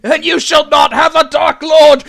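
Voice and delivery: shouted, high, grandiose evil queen; roaring tyrant queen. Acted. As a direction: bellow it like a mad ice queen. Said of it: "declares herself to be Queen of Snow Mountain".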